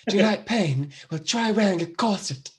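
a deliberately bad stab at Keira's voice; Keira Knightley impression. Host attempting Keira's Pirates line, a clumsy put-on voice. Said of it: "No, that was terrible".

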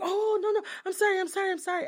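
high-pitched, repeated hasty apologies; flustered apology. Acted. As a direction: imitate a startled man backing off. Acts startled man apologizing.

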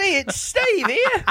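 very high, very slow hammed-up Cockney; mock Cockney Steve. Podcaster mocks himself for the listeners, hammed-up squeaky Cockney. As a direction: stretch it out in a squeaky put-on Cockney. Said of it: "It was my Steve doing Cockney".

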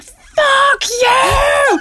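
high, loud screamed curse. Retold or imitated. Mother screams at the runner who judged her, loud and high. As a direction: scream it across the park at him. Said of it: "and I just screamed".

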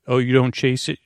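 ordinary talk, nothing marked

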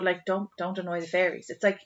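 ordinary delivery

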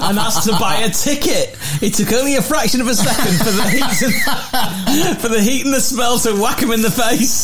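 laughing while reading. Real, not acted. Host reading listener's fart story, laughing. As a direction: read it through helpless laughter.

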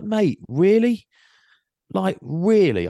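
high, drawn-out disbelief; incredulous. Retold or imitated. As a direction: stretch it out high, appalled at the pettiness. Retold incredulous row with cyclist.

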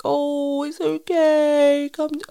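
high, slow, cooing comfort; comforting mum. Retold or imitated. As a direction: soothe a crying toddler. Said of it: "and I was just like".

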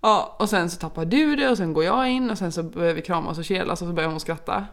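usual speaking voice

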